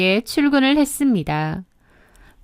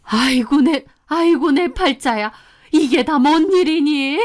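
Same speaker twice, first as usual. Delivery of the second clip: high-pitched, wailing mother-in-law; theatrical woe-is-me outcry; melodramatic lament; 4 semitones higher, 3 dB louder than usual. A narrator's reading. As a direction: bemoan your fate as if about to collapse. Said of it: "뒷목을 잡고 쓰러지는 신용까지 하셨습니다".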